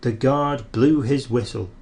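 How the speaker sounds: ordinary delivery, nothing marked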